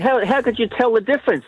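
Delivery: mildly surprised wife, voice lifted high; a bit surprised. Acted. Wife questioning her husband's fly count, mildly surprised. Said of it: "a bit of a surprised look on her face".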